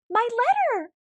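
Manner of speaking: schel hoog en luid: mijn brief!; boze uitroep. Acted. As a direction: grijp hem terug en roep het uit. Kibbelende dieren grijpen brief.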